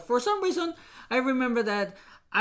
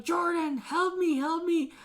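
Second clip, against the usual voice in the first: brother pleading; 7 semitones higher, slower than usual. Retold or imitated. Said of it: "he's like".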